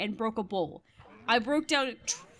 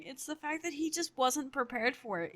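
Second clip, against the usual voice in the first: usual speaking voice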